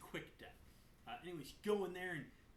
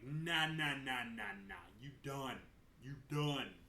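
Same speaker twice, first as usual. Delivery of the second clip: louder sassy brush-off; mock dismissive; 4 semitones lower, 4 dB louder than usual. Jaina fed up with the Horde, mock sassy refusal. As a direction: shut them down with attitude. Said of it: "it's like".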